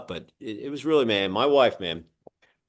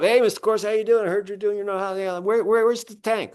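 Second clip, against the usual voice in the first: imitated septic inspector, high eager greeting; Jeremy; 8 semitones higher than usual. Septic inspector greets homeowner, looking for the tank, high-pitched impression. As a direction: mimic a chummy inspector in a much higher voice. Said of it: "So this guy, Jeremy, comes up".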